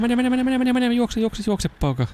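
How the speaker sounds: egging a runner on, high and eager; excited urging. Real, not acted. Urging game character to run.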